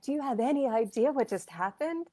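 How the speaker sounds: high, disbelieving question; incredulous. Retold or imitated. Medium to her oblivious client, high and incredulous.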